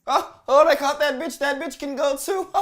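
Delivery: high, loud, thrilled reaction; gleeful outburst. Real, not acted. Host reacting to judge ejecting someone, gleeful. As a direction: crow with delight at the takedown.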